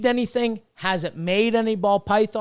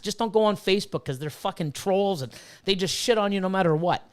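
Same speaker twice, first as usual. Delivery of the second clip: ordinary talk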